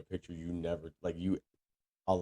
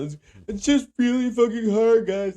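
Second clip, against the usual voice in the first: high, loud whiny fake sobbing; mock a teary vlogger, high and loud; fake crying influencer; 9 dB louder than usual. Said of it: "take a video of yourself crying".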